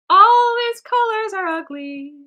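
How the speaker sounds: high, loud, drawn-out whine; escalated wailing complaint. Real, not acted. Artist despairs over the ugly colors, loud wailing complaint. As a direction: wail it slowly, way up high.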